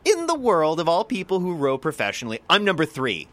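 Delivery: high, loud incredulous rant, imitated; Silken Lemon berating. Silken Lemon tearing into the reporter, high loud incredulity. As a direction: demand an answer, half laughing at the absurdity. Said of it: "berating the reporter".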